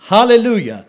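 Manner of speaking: hallelujah called out high and loud; thunderous hallelujah. Acted. Pastor voicing the multitude like thunder, loud and high. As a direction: let it ring like thunder. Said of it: "mighty peals of thunder".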